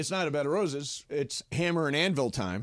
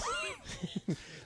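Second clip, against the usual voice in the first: high squeal of laughter; 9 dB quieter than usual. Real, not acted. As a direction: burst of high giggling. Laughing at own joke.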